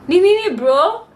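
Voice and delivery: high, loud indignant protest; exasperated. Real, not acted. Storyteller challenges the problem-seeking teacher, exasperated. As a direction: throw up a loud, exasperated question.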